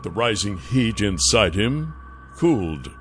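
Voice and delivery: plain reading voice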